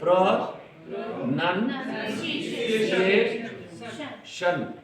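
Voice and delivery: प्रोनंसिएशन drawn out very slowly, pro-nun-si-a-tion; syllable by syllable. Shown as a demonstration. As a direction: break प्रोनंसिएशन into slow syllables.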